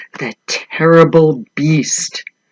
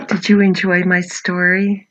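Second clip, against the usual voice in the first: ordinary delivery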